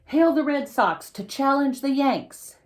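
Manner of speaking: high, loud call hailing the team; excited announcing. Acted. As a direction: announce the team to a cheering crowd. Announcing teams in the story.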